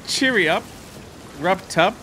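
hammed-up Cockney call; Cockney accent. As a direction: chirp it in a fake British accent. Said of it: "Jay totally went there".

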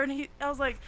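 ordinary talk

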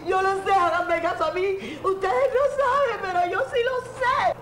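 high, loud, laughing into sobs; breaking down crying. Acted. Wife insists to the doctors in tears that she knows. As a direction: break down insisting you know.

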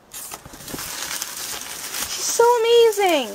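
high, very slow swooning gush; gushing, drawn out. Real, not acted. Girl praising friend Laura to camera, swooning gush. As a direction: stretch every word like you're melting.

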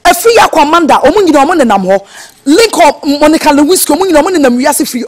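loud, heated telling-off; angry tirade. Acted. Friend telling off the blackmailer by phone, furious. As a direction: lay into the caller at full force.

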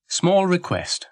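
brisk little story-character voice. A narrator's reading. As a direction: put the request crisply and businesslike. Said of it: "said Florence briskly".

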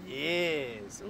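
ordinary talk

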